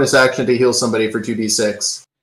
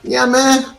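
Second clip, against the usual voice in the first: kobold voice; 11 semitones higher than usual. Acted. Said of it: "kobold just goes".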